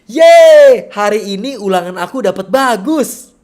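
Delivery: gleeful high, loud cheer; excited reaction. Shown as a demonstration. Student celebrating a good test score, excited. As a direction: cheer it out with a big high "Yeay" and lively swoops. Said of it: "gimana reaksi kamu?".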